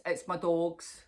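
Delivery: ordinary talk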